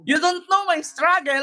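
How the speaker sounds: high-pitched mock grievance; mock protester. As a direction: mimic her wailing complaint. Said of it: "Ano ba yung struggle niya?". (kunwaring naghihinanakit, mataas ang boses)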